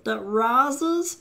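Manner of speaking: Meatwad impression. Host plays Meatwad selling a comic book, very high cartoon voice. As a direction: talk like a squeaky little meatball pitching a book. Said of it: "my Meatwad impression".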